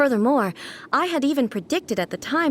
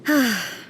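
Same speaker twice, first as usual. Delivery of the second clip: weary sigh. Acted. Tired sigh before answering.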